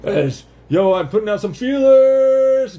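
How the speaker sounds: high, loud, slow call; calling from far away. Acted. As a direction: stretch it out like calling across space. Voicing a particle calling out.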